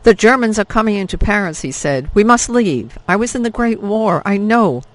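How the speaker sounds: a veteran begging everyone to flee; panicked wheezing man. Acted. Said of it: "florid, wheezing man".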